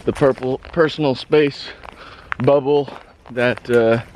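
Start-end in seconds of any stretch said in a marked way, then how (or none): none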